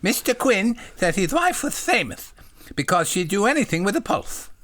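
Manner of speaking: high, simple-minded little voice; eager, high-pitched polite question; childlike John. Acted. Voicing John in the story.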